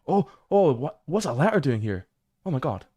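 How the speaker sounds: high, loud burst of surprise, settling a little; real jolt of surprise at an unexpected find; startled surprise. Real, not acted. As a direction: stumble over the words as if caught off guard. Startled by finding an unexpected letter.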